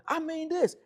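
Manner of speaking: very high, loud mock retort; high-pitched upset voice. Woman arguing back at her man, high-pitched. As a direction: imitate her voice climbing in an argument. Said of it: "back and forth with her in that voice".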